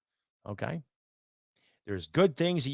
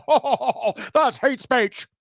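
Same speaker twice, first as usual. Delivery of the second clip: mocking quote; 13 semitones higher, 5 dB louder, much slower than usual. Mocking lefties' reaction.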